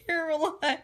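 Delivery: high, laughing wail of a name; mock tearful wail. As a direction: wail the name as if about to cry. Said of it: "Way to make me cry".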